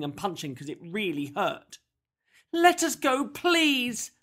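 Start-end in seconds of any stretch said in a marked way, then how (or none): 2.5-4.1 high, loud desperate plea; child pleading for release; begging. A narrator's reading. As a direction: beg urgently, pitched way up. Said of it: "begged Tizzy".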